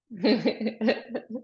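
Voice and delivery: short, light chuckle. Real, not acted. Exercise teacher teasing a sleepy participant, light chuckle. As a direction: chuckle briefly after a teasing remark.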